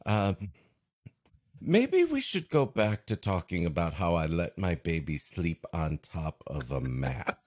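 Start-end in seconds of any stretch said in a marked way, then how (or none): none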